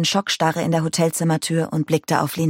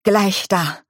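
pressed out; 3 semitones higher than usual. A narrator's reading. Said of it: "presste dieser hervor".

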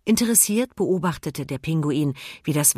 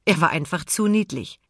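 plain reading voice

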